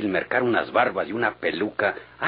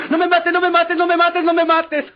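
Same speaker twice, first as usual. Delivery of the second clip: much higher, louder squealing mimicry of his pleas; mocking imitation; 6 dB louder than usual. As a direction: ham up his panicked begging like a stuck pig. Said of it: "Parecías marrana atorada gritando". (imitación burlona y chillona de sus súplicas)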